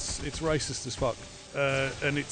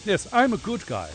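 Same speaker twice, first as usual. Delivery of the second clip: hammed-up upper-class good guy; self-satisfied toff accent; mock upper-class accent; 5 semitones higher, 5 dB louder than usual. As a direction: put on an upper-crust English accent. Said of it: "I have an upper class accent".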